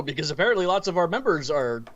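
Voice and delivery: usual speaking voice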